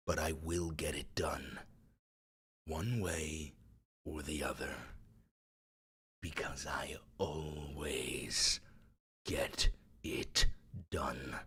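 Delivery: hushed, whispered ending; dramatic whisper. Shown as a demonstration. Streamer whispers the ending of the dramatic reading. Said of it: "dramatic reading".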